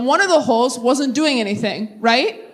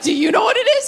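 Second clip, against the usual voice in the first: put-on defensive kid; 9 semitones higher, faster than usual. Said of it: "I was like".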